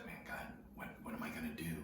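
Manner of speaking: hushed, desperate plea to God; soft pleading whisper, half to himself; whispered prayer. Retold or imitated.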